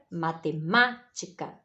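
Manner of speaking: "matemática" with MÁ leaned on, slight break after it; ênfase na sílaba tônica. Shown as a demonstration. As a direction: stress the third syllable of "matemática", MÁ. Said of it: "esse ma". ("matemática" com destaque no MÁ acentuado)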